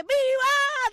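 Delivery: high, loud mock cheer; a room full of Cubans cheering, shouted; crowd shout. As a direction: yell it like a whole crowd of Cubans. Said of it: "Todo el mundo grita".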